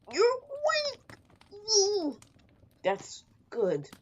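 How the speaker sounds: high, delighted squeal of a greeting; excited stranger. Acted. Stranger thrilled the hero woke up, squealing high. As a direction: greet them with bright high joy.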